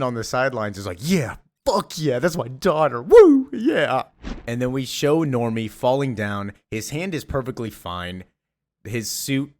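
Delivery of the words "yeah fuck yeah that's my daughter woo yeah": loud, high mock whoop; imitated cheering. Dad cheering his daughter from sidelines, loud whooping imitation. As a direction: cheer like a proud sports parent.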